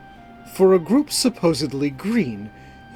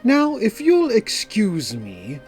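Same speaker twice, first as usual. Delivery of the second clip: slow, higher, polished courtesy; Calor, smooth; 7 semitones higher, 3 dB louder, slower than usual. A narrator's reading. Calor excusing himself to fetch the gem, smoothly. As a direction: politely take your leave, drawn out. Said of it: "Calor replies smoothly".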